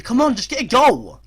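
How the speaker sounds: loud, high plea for a goal; exasperated urging. Real, not acted. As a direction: cry out in frustration at your team. Urging his team to score.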